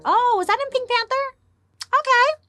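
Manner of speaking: a startled high squeak at her own accent; squeaky surprise. Real, not acted. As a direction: squeal it like you caught yourself.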